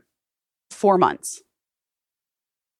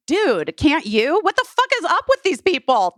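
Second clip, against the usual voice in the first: exasperated disbelief; 12 semitones higher than usual. Retold or imitated. Exasperated retort to mom, retold.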